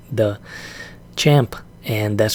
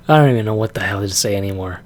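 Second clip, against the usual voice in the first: ordinary talk, nothing marked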